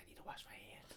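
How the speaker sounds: hushed whisper to herself. Retold or imitated. Kid back home from the mud, whispering about washing hands. Retold kid muttering to self.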